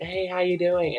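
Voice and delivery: high, loud, over-friendly hello; imitated high-pitched smooth distraction; put-on friendly greeting. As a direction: greet a stranger with exaggerated chumminess.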